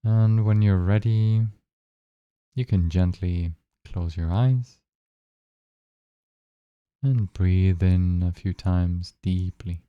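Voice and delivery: calm, even guiding voice with long pauses; unhurried phrases, spaced out with long silences; guided meditation. Acted. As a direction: lead listeners gently into noticing their breath.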